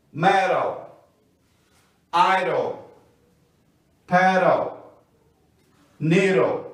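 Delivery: each word said slowly with the T or D tapped like an R; flap word drill. Shown as a demonstration. Teacher modelling flapped D words for students to repeat. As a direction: read the list clearly, pausing after each word. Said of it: "vamos ver mais exemplos do D com som de R".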